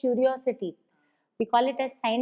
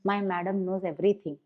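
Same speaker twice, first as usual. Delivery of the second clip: lightly voiced pupil, lower and softer; admiring pupil; 6 semitones lower, 7 dB quieter than usual. Pupil speaking of a well-equipped teacher, quiet admiration. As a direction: quietly quote a child's respect for the teacher. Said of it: "పిల్లలకు మీ పైన రెస్పెక్టే వేరే ఉంటది".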